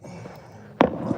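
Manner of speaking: breathless amazed oh; hushed wonder at parents kissing; awed oh. Retold or imitated. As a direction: gasp it like a kid seeing something magical. Awed kid seeing parents kiss.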